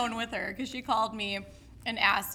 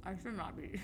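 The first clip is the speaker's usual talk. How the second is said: chewing while talking; 2 semitones lower, 10 dB quieter than usual. Real, not acted. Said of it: "You can eat".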